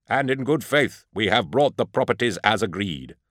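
high, declaiming envoy voice; formal proclamation. A narrator's reading. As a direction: proclaim it ceremoniously to an assembly. Envoy addressing aliens across platform.

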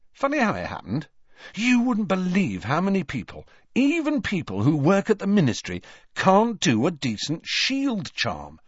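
high, lively salesman twin; joke-shop twin George, chatty. A narrator's reading.

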